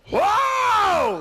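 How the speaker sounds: long, high, loud wail; wife's huge yell. Retold or imitated. Husband imitating his wife's first labour scream, huge yell. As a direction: let out a startling labour howl. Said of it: "she made a yell like I've never heard before".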